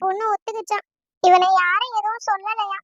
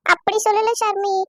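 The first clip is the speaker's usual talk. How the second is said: ordinary talk, nothing marked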